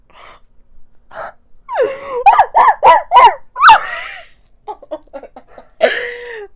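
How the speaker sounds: long, loud, high squealing laughter; shrieking laugh. Real, not acted. Gushing over the Spider-Man kiss, squealing laughter. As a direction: lose it completely in giddy squeals.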